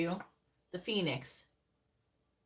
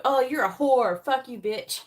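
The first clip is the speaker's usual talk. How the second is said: loud mock rant, higher pitched; angry insult; 5 semitones higher, 9 dB louder than usual. Rejected guy loudly insults the woman who turned him down. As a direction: spit it out like a spurned guy. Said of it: "when they go".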